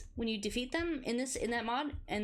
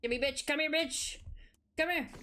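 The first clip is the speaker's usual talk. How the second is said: excited yelling; 6 semitones higher than usual. Real, not acted. Chasing a spider to kill it.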